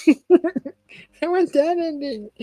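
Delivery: a laughing, longing repeat; laughing, drawn out. Real, not acted. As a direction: stretch it out while laughing.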